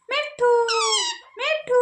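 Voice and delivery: word demo for parrot. Shown as a demonstration. Prompting the parrot to copy, high and stretched. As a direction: model Mithu high, holding the final vowel. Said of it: "मिठू बोलो".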